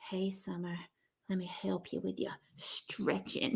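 mock-intimate whispered offer of help; very soft, put-on whisper; hammed-up sultry whisper. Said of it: "He didn't really say it like that".

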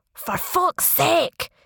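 slow, high Scottish yell of pain; Scottish scream. Acted. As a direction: bellow it in a Scottish accent. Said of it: "he screamed in a Scottish accent".

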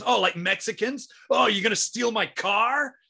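high, loud, mock American heckle; ranting American comic. Comedian abusing backpackers in the crowd, high mocking rant.

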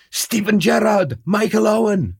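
high and loud, delighted recognition; excited footballer names. Retold or imitated. Guard greeting a Liverpool fan, loud and excited. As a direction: rattle off the names like a thrilled fan.

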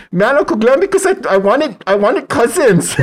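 high, loud wail of a tearful nephew; mock crying child. Nephew crying to his uncle over cousins, mock wailing. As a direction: imitate a child's crying complaint, laughing at the end. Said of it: "he started crying".

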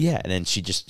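normal talking voice

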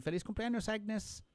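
high, bright surprise shout; a crowd yelling surprise at the door; surprise party cheer. Retold or imitated. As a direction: call it out like springing a surprise.

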